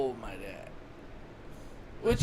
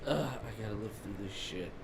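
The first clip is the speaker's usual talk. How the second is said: mock weary groan; 9 semitones lower, 7 dB quieter than usual. Voicing a reluctant dad.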